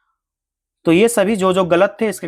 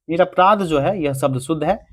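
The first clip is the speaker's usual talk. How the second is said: ordinary talk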